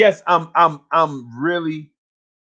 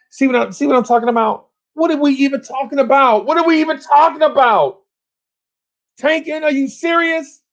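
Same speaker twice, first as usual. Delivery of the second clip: disbelieving yell; 9 semitones higher than usual. Real, not acted. Fan yelling about the team tanking, exasperated shout. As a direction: shout it like you can't believe it.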